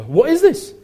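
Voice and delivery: high, loud astonishment; amazed question. A narrator's reading. The people asking each other about Jesus' teaching, amazed. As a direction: pitch it way up in amazement. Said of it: "amazed that they asked each other".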